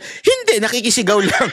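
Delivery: bystander reply. Pretend. Bystander explaining he is only joining the screaming, casual. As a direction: shrug it off.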